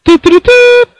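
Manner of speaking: very high silly toots; high squeaky toot. Pretend. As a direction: squeak it like a toy horn. Friend interjects a silly sound.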